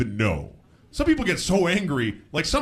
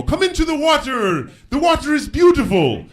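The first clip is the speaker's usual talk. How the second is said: put-on dream boys' invitation; 12 semitones higher, 6 dB louder than usual. Comic voices Italian boys in a dream inviting him, high and singsong.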